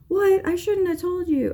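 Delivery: high squeaky voice of a daughter caught out; shocked daughter. Retold or imitated. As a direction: squeal it like a kid who let a secret slip.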